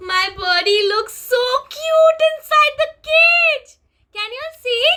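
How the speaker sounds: delighted. Real, not acted. Teacher delighted at caged bird.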